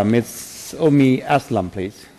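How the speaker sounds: ordinary talk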